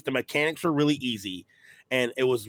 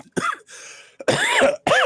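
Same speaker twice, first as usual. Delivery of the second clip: coughing fit; 7 dB louder than usual. Real, not acted. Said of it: "Sorry, I have a cold".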